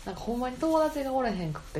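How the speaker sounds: ordinary talk, nothing marked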